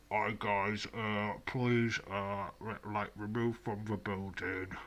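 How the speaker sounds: husky voice through a covered mouth; hand over mouth. Retold or imitated. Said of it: "I talk kind of husky with my voice and over my mouth".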